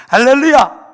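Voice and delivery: 웃음 뒤 "할렐루야"를 높고 크게, 길게 끌며 외친다; 회중을 깨우듯 힘차게 외쳐라; 우렁찬 외침. Real, not acted. Preacher's rousing call to congregation. (설교자가 회중에게 길게 끌어 외치는 할렐루야)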